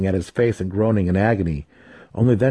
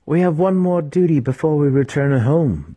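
higher, firm priestess voice; commanding cleric; 7 semitones higher, 3 dB louder than usual. A narrator's reading. Cleric telling the raiders their last duty, firm. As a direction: lay down the duty. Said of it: "the cleric explained".

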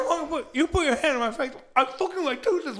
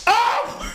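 pained cry; 10 semitones higher, 4 dB louder than usual. Acted. Pained fake cry after hit.

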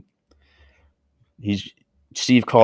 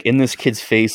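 usual speaking voice

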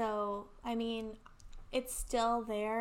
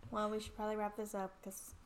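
usual speaking voice

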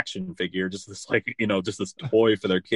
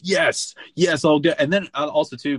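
put-on eager little boy voice, a bit higher and louder; excited kid; 6 semitones higher, 5 dB louder than usual. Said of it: "And he goes".

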